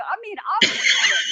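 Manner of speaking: bursts out laughing mid-line, high and loud; real laughter breaking through the words. Real, not acted. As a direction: laugh it out at yourself. Laughing at her own campaign naivety.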